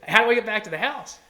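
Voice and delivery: bright and loud, oblivious to the drama; imitating the friend. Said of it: "He goes".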